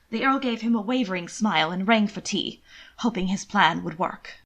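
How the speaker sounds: ordinary delivery, nothing marked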